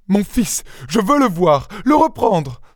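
high, urgent woman's plea; desperate mother. A narrator's reading. Young wife wanting her son back, desperate.

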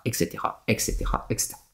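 trailing off to a whisper; fading whisper. Real, not acted. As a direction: let it fade into a breathy whisper.

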